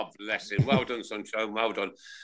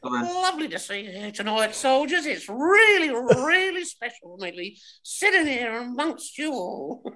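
Dame Edna; 12 semitones higher than usual. Retold or imitated. Addressing the soldiers as Dame Edna, high gushing falsetto. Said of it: "a bit of Dame Edna".

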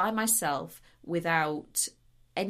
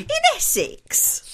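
high, loud, slow disbelief; incredulous; 11 dB louder, slower than usual. Real, not acted. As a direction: squeal it in disbelief. Incredulous question.

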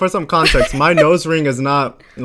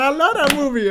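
very high imitation of delight; hammed-up high-pitched enthusiasm; mock gushing; 14 semitones higher than usual. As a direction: gush it in a high squeaky pitch. Acts out his overexcited answer.

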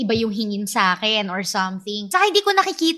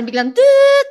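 horn toot imitation; 13 semitones higher, 7 dB louder, slower than usual.

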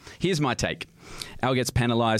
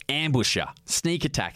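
ordinary delivery

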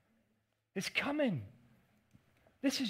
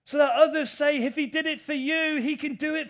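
louder, higher rising preacher cry; raised, driving sermon voice; preaching at full force; 5 semitones higher, 9 dB louder than usual. Acted. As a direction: lift the crowd with it.

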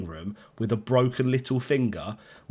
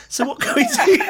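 high, louder mock nurse voice, laughing; 7 dB louder than usual. Mocking the nurse calling him in. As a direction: squeak out the nurse's question. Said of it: "It's like".

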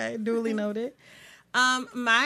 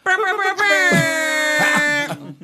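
sound effects; 5 semitones higher, 7 dB louder, much slower than usual. Pretend. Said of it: "Sound effects".

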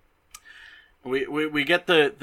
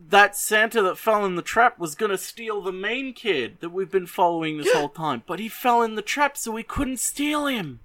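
excited realization; 10 semitones higher than usual. Retold or imitated. Characters realizing the Santa in the trap was the wrong one, alarmed. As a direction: voice climbing higher and higher in alarm. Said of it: "And it's like".

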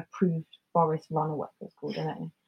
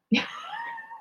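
high-pitched embarrassed laugh; high squeal laugh; 6 dB quieter than usual. Real, not acted. Laughs after saying cross.

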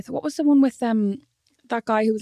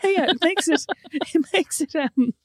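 imitating Holly, laughing; 7 semitones higher, slower than usual. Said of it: "She's like".

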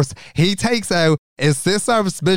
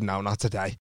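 quiet; 8 semitones lower, 11 dB quieter than usual. Retold or imitated. Retold quiet refusal.

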